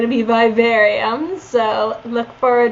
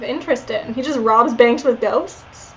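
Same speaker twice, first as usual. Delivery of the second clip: ordinary talk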